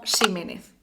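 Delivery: "Ximénez" starting with a sh or s sound; old-fashioned "Ximénez", soft initial sibilant; archaizing pronunciation. Shown as a demonstration. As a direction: say "Ximénez" as Siménez, without jota. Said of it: "la pronunciación arcaizante".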